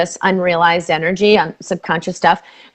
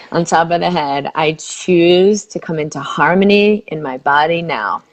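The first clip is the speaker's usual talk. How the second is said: soothing tapping script; guided tapping; 3 semitones lower than usual. Acted. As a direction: lead the phrase gently for her to repeat.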